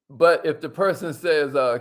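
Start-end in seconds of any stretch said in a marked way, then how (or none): none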